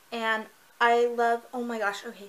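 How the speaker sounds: ordinary talk, nothing marked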